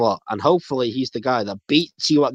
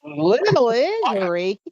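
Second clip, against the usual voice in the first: incredulous; 11 semitones higher than usual. Real, not acted. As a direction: stretch it out in disbelief. Incredulous repeat of Cena quote.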